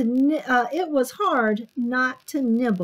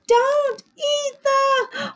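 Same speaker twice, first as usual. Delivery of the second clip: slow, high, loud shout; yelled warning; 6 dB louder, much slower than usual. A narrator's reading. Children warning Sammy what not to eat, yelled. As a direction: yell word by word, left hanging. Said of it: "yelled the children".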